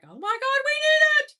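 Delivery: high, loud re-enacted squeal of excitement; excited outburst. Shopper recalling a bag drop, excited outburst. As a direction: blurt it out, wildly overexcited, mimicking yourself.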